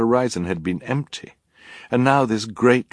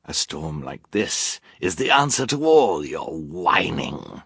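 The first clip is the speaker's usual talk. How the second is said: villain voice. Acted. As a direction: drawl it like a gloating rogue. Narrator voices villain Count Olaf.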